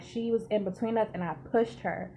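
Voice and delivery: ordinary delivery, nothing marked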